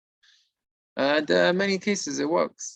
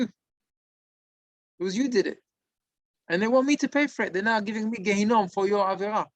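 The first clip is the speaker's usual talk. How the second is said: retold indignant accusation, raised pitch; furious; 6 semitones higher than usual. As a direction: play the dead partner accusing, mildly heated. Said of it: "and he's all furious".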